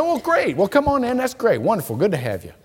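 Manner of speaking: bright high-pitched greeting, falling at the end; hammed-up delighted welcome, high then settling; retold cheery welcome.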